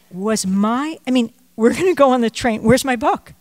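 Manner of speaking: anxious dad asking for his book; imitating father. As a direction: fret about the lost book.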